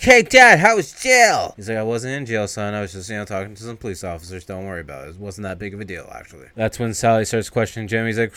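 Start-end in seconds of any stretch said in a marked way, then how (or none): 0.0-1.5 put-on much higher, louder child's voice; excited little kid. Young son running to hug his dad, high and loud. 1.8-6.5 softer, calming reassurance; reassuring dad. Retold or imitated. Father calming his son about jail, soft and reassuring.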